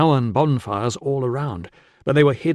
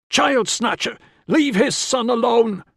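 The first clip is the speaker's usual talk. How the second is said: high-pitched angry mob cry; accusing shout; 11 semitones higher, 3 dB louder, slower than usual. A narrator's reading. Fur trader accusing a stranger of taking a child, shouting. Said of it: "shouting at him and pointing".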